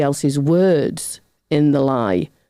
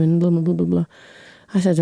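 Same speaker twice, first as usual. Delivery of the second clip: normal talking voice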